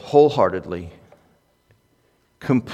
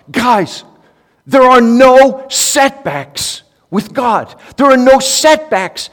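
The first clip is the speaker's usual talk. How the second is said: much higher, louder and slow, hammering the words no setbacks; passionate preaching; 12 semitones higher, 9 dB louder, slower than usual. Retold or imitated. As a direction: preach it to the crowd with fire, again and again. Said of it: "and he's just passionate".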